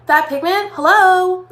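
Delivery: loud, high delighted hello; excited. Real, not acted. Makeup vlogger to viewers, loud thrilled admiration. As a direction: show off the pigment, thrilled.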